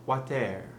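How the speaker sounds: French-accented WATER ending in an open "air"; slow French-style WATER, final R turned to "air". Shown as a demonstration. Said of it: "E R at the end of a word like water becomes more like air".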